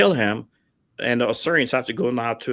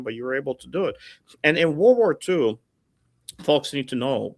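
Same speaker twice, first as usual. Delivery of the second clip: ordinary delivery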